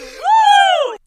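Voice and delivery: high, loud, drawn-out "woo"; party whoop. Pretend. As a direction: let out a big whooping cheer. Whoop capping the rowdy advice.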